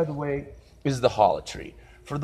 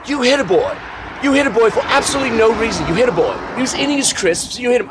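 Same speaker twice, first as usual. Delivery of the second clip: high loud indignant British scolding; indignant British man; 11 semitones higher, 5 dB louder, faster than usual. Retold or imitated. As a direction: tell him off in a posh outraged voice. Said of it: "Sir Lancelot is explaining to him".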